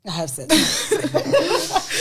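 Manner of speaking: slow, laughing through the words; drawn-out laughing confession; laughing admission. Real, not acted. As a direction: own up with a laugh. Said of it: "you have".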